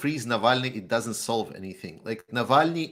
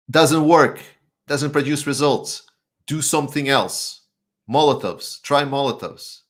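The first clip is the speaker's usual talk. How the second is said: forceful rant; 4 semitones higher, 8 dB louder than usual. Real, not acted. Heated rant urging violence.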